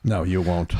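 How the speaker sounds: normal talking voice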